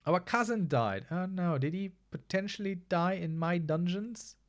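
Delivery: normal talking voice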